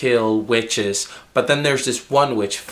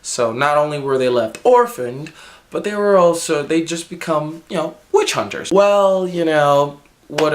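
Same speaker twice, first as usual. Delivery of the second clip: ordinary delivery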